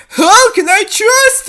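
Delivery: high, loud, slow doubtful plea; fearful shout. Acted. Dangling princess shouts her doubt down to him. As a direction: cry out your doubt.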